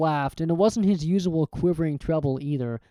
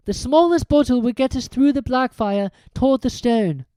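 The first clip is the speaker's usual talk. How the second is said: put-on higher girl's voice; Hermione explaining; 8 semitones higher, 4 dB louder than usual. A narrator's reading. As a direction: state the answer clearly.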